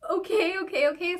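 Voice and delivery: high-pitched, hurried giving in; mock-panicked agreement; flustered compliance. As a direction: re-enact nervously obeying an order. Flustered compliance with angry officer.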